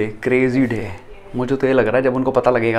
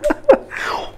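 quick unvoiced snicker; stifled laugh. Real, not acted. Son to camera stifling a laugh after dad leaves. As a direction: giggle under the breath. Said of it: "धीरे हंसूंगा".

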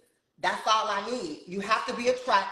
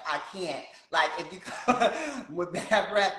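ordinary talk

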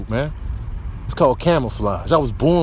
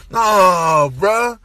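higher, louder disgusted refusal; 7 semitones higher, 4 dB louder, slower than usual. Acted. Cat rejecting healthy food, loud disgusted refusal. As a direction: reject it with a shout.

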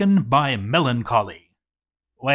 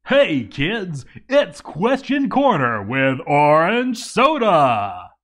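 mock kids' show host; 4 semitones higher, 5 dB louder, slower than usual. Kids' show intro announcement.

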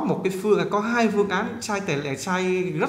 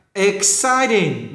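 "Exciting" louder, with a drawn-out second syllable; nhấn âm hai; 3 semitones higher, 5 dB louder, much slower than usual. Shown as a demonstration. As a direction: slowly punch CIT in "Exciting". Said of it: "nhấn âm hai". ("Exciting" đọc chậm, nhấn âm hai)

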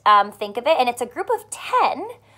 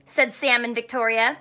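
put-on storybook read-aloud for kids; reading to children. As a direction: read it warmly to young listeners. Teacher reads picture book aloud.

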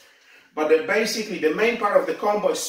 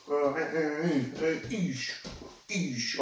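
sharp breathy hisses with each strike; exertion grunts; 8 dB quieter, slower than usual. Shown as a demonstration. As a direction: hiss out air on every strike. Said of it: "Little movement".

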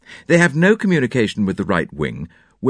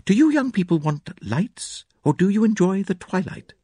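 lady of the house voice; 10 semitones higher than usual. A narrator's reading. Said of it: "she asked".

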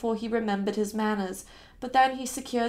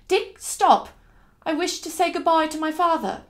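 raised, louder abrupt order; sudden exclamation; 6 semitones higher, 6 dB louder than usual. Acted. Passenger halting her driver at the churchyard, sudden raised voice. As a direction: blurt it as if just thought of. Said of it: "before I said suddenly".

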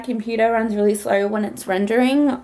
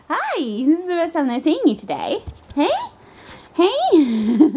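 ordinary talk, nothing marked

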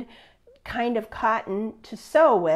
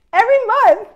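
higher and louder, words breaking into laughter; laughing; 9 semitones higher, 6 dB louder, faster than usual. Real, not acted. Teacher tells the class her joke, laughing. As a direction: laugh through the punchline. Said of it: "Totally cracked up".

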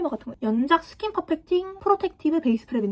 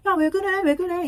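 alarmed classmate; 7 semitones higher, 3 dB louder than usual. Retold or imitated. Kids crying out during a fight.

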